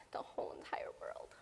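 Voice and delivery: grieving, whispered; tearful whisper. Real, not acted. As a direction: whisper through tears. Grieving her sister.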